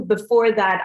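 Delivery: normal talking voice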